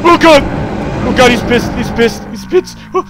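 high, loud panicked yelling; frantic repeated warning; panicked alarm. Real, not acted. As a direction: cry out as an angry monster turns on you. Monster turns angry on player.

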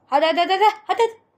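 will it through, pitch rising; eager urging. Real, not acted. Gamer pushing for the last valve, climbing eager chant.

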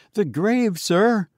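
high, nervous babbling; frightened. A narrator's reading. Frightened sexton.

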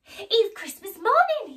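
high, loud joyful exclamation; exclaiming. Acted. Scrooge waking on Christmas morning, high and joyful. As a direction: burst out with joy. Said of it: "he exclaimed".

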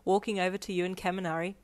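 ordinary delivery, nothing marked

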